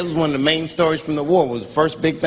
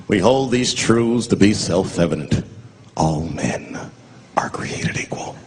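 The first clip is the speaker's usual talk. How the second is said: solemn recitation; 6 semitones lower, slower than usual. Pretend. Reciting the Declaration.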